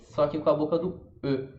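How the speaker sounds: ordinary talk, nothing marked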